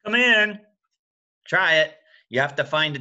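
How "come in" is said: loud high knock-knock; knocking sound. Shown as a demonstration. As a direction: rap it out like a hand on a door. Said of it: "sound like a hand on a door".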